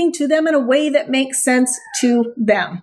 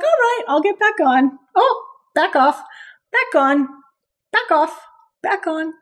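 voicing the dog; 3 semitones higher than usual. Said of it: "They're like".

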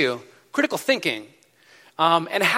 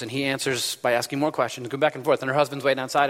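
usual speaking voice

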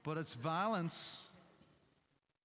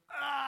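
hammed-up Braveheart war cry; battle cry; 9 semitones higher, 5 dB louder than usual. Speaker imitating Braveheart's battle speech, a sudden mock war roar. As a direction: roar it like a warrior rallying troops. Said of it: "that fantastic speech which goes like".

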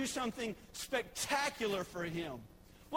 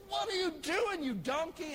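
higher, exasperated rebuke; angry Balaam; 6 semitones higher than usual. Retold or imitated. Balaam yells at his stalled donkey, mock anger. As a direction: scold the beast in mock anger. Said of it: "And Balaam says".

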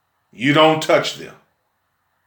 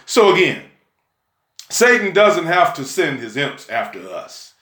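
normal talking voice

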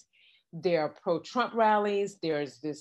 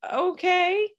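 incredulous retort; 8 semitones higher than usual. Retold or imitated. To the protester blocking the road, high incredulous retort. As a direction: pitch it up in exasperated disbelief at the blocker.

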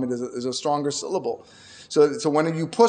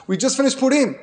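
preaching at full force; 3 semitones higher, 4 dB louder than usual. Acted. Rabbi urging listeners to hold back their words, loud preaching. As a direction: drive the lesson home to the congregation.

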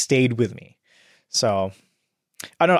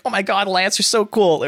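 fawning henchman; 6 semitones higher than usual. Retold or imitated. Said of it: "They're like".

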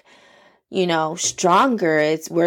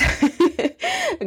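light high laugh; 11 semitones higher than usual. Real, not acted. Laughs after her own point.

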